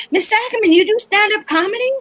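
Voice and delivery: put-on high, eager kid's question; lightly voiced teenage boy; student's voice. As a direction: play the curious teen asking.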